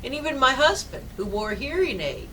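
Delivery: normal talking voice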